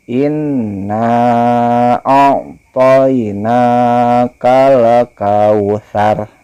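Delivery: slow, melodic chant with long vowels; Quran recitation. Shown as a demonstration. Teacher models the verse for pupils, slow chant. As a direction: recite slowly, stretching each vowel. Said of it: "jangan dibaca, jangan dibaca kao".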